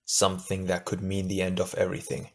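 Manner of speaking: quiet, hollow admission. A narrator's reading. Tahu admits the danger to Gali, hollowly. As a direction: say it low-key and hollowed out. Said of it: "Tahu replied hollowly".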